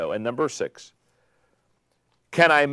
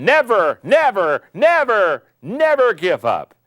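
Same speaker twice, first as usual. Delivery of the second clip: higher and louder, a hint of Churchill's slow defiant rallying cry; lightly voiced Churchill hammering each never; 6 semitones higher, 6 dB louder, slower than usual. Quoting Churchill to the audience, a mild Churchill impression. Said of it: "It's Churchill saying".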